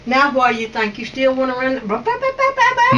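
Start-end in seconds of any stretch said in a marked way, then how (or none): 1.9-3.0 much higher, teasing blah blah; mock babble. To David, mocking his talking, high mock babble. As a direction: mimic his chatter, squeaky and silly.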